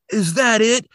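high-pitched, indignant imitation of an angry detective; pissed-off rant. Horn rants about the killer's challenge, mock pissed. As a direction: retell his ranting questions in a worked-up high voice. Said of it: "Horn gets pissed. He's like".

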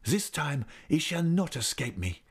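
high, determined outburst; exclamation. A narrator's reading. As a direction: exclaim it as a heated vow. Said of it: "he exclaimed".

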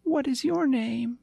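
high, eager little puppy; a lost small pup, chirpy and sweet; yappy panting puppy. Acted.

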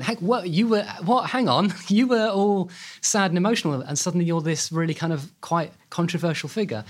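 high-pitched, incredulous mock double take; baffled disbelief. The public reacts to her sudden celebrity, incredulous. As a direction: act out a confused public, pitched well up, half laughing.

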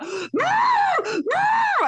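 very high muffled mock shriek; mock snorkel scream. Snorkeller fleeing a tiny fish, muffled mock panic. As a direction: imitate panicked yelling into a snorkel. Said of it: "snorkel back in his mouth".

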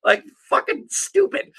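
high, incredulous scorn; mock the stupidity; scoffing. Real, not acted. Scoffing after the bit.

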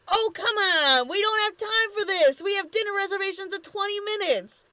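high-pitched, impatient outburst; exasperated whining yell. Retold or imitated. Lube to Miss B as she tends Fred, exasperated yelling. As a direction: rush into an exasperated, high complaint. Lube's tantrum, continuing his yelling.

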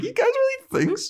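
extremely high squeaky hopeful voice; giddy Buffy. Retold or imitated. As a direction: squeak it out starry-eyed. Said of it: "Buffy's like".

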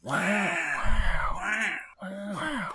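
airy wows, dying away to a near-whisper; soft breathy surfer wow; breathy Owen Wilson. Acted. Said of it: "It's kinda breathy".

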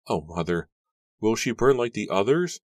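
high, loud, distressed question; anguished, rising plea; choked cry. A narrator's reading. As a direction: cry out in dread to your mother. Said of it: "Susanna cried, choking out the words".

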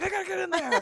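loud, high hyped wrestler shout; wrestling promo. Retold or imitated. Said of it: "That was a good, like, wrestler voice".

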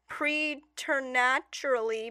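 preternaturally sounded out slowly in pieces; halting reading. Real, not acted. Co-host struggling to read, slow, careful preternaturally. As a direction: labour through "preternaturally" bit by bit.

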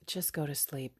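quiet coaxing to go back to sleep; soft sleepy coaxing. Retold or imitated. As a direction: coax gently, almost under breath. Tempting inner voice.

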